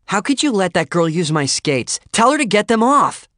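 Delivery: high, loud outburst, building; angry interruption. A narrator's reading. Lorraine to narrator about her skates, furious outburst. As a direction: cut in furiously, rising to a shriek. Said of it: "Lorraine interrupted, shooting a dirty look".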